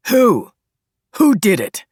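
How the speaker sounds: urgent shouted questioning; calling out. A narrator's reading. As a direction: call the question across the room. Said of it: "calling to Christoph".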